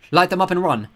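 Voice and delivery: tense decision under attack; urgent. A narrator's reading. Girl tells Walt to light them, loud and urgent.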